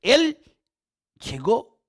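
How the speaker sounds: ordinary talk, nothing marked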